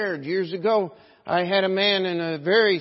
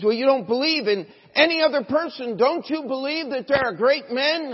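high, loud indignant challenge; imitated heated argument; 9 semitones higher, 6 dB louder than usual. Church member challenging his pastor, imitated heated argument. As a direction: press the point, acting exasperated.

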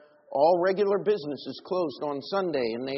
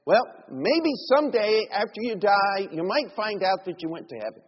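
sarcastic; 5 semitones higher, 6 dB louder than usual. Retold or imitated. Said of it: "I'm being a little sarcastic".

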